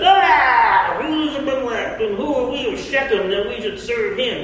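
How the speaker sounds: loud, high southern drawl, mocking; southern accent. Gaal scoffing at Abimelech before the lords, in a loud southern accent. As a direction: sneer it out in a big southern accent. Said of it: "Notice how I go into southern accent".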